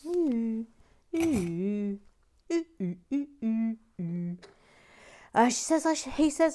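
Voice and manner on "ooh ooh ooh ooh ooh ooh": higher, excited cooing; playful delighted oohs; admiring oohs. Real, not acted. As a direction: coo over the little details. Admiring build.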